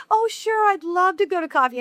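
bright, high, sugary politeness; fake friendly. Fake-friendly reply to a coffee invite. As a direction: put on a sweet fake yes. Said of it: "on the outside you're saying".

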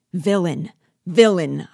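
two rising furious cries of villain; exclaimed. A narrator's reading. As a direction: hurl the word at him in fury. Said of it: "I exclaimed".

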